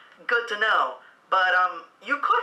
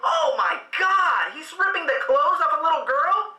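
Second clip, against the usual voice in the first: high, shocked shout; shocked outcry; 7 semitones higher than usual. Real, not acted. Shocked reaction.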